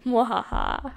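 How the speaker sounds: mock evil cackle; evil laugh. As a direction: cackle like a villain. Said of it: "I am evil".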